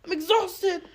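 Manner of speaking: high, exasperated mock yell; retold yell. Worn-out woman yells at her partner, mock exasperated yell. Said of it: "she, like, yelled at him".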